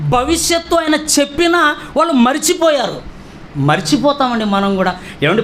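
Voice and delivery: ordinary talk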